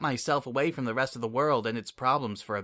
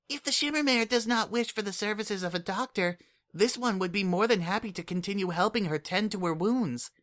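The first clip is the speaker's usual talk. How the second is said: put-on much higher, earnest little changeling kid; Kukri's voice; 7 semitones higher than usual. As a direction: speak up like an eager, caring child. Kukri speaks.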